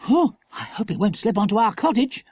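put-on a touch of childish alarm; half scared. A narrator's reading. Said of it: "half scared".

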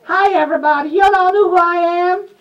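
high-pitched perky hen puppet; little red hen. Pretend. Puppet greets boys and girls, high little red hen voice. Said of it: "I'm the little red hen".

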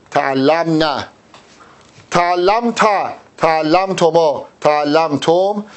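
low, slow, drawn-out chant of each verb form; teacher chanting the past-tense table for students; slow paradigm recitation. Shown as a demonstration. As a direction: recite the conjugation one form at a time.

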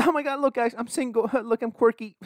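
put-on high, giggly girly voice; mock quirky girl. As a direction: play a ditzy girl showing off.

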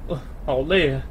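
usual speaking voice

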